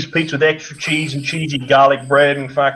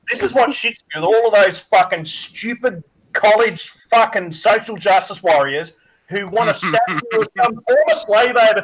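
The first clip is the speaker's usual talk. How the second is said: ordinary delivery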